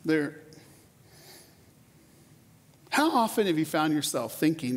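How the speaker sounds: usual speaking voice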